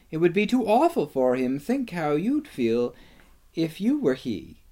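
high-voiced, earnest objection; protesting girl. A narrator's reading. Susan argues with Lucy.

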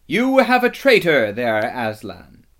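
piercing villainess voice; accusing witch. A narrator's reading. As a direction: ring out the accusation, then drop on the name. Witch confronts Aslan with accusation.

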